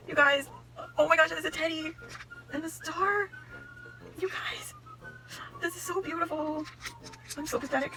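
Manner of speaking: high, tearful wonder; overwhelmed, squeaking through happy tears; small high voice, about to cry; close to tears. Real, not acted. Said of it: "I'm gonna cry".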